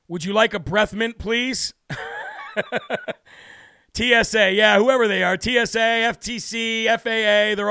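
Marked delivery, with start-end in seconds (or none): 1.8-3.1 helpless high-pitched laugh; high squealing laugh. Real, not acted. Cohost laughing at the host's rant, high and helpless. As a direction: burst into a shrieking giggle. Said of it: "Your face is getting red".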